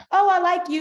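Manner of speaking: ordinary talk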